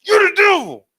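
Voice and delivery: high, loud mock shout; men letting loose at camp, shouting. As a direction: yell it out at camp.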